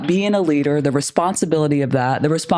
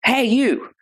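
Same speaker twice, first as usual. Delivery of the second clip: sharp high call across a parking lot; 7 semitones higher, slower than usual. Retold or imitated. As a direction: yell after him to stop. Calling out to employee in lot.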